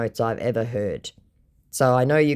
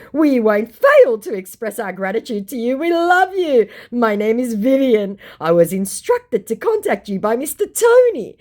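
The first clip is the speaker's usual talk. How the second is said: mock scammer voice; 9 semitones higher, 5 dB louder than usual. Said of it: "Vivan Delee".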